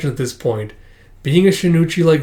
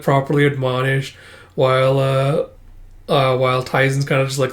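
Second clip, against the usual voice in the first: usual speaking voice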